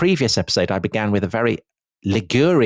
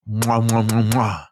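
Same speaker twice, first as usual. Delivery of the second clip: mock kisses. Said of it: "A thousand loving kisses".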